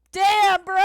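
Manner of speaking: very loud, high outburst; full-volume cry of offence; indignant yell. Real, not acted. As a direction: yell it out, wounded and outraged. Outburst at being called delusional.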